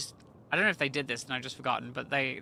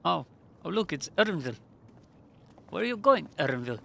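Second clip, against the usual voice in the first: put-on accidental South African accent; 2 semitones higher, 3 dB louder than usual. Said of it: "Why do I sound like I'm from South Africa now?".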